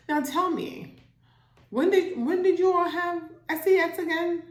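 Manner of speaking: fake-friendly questioning; sly interrogation. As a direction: [name] grilling the woman from the pastor's desk. [name] interrogating at the desk.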